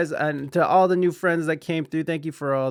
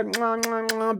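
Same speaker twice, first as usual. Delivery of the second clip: blowing kisses; 6 semitones higher than usual. Real, not acted. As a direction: blow kisses at the chat. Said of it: "Big kisses".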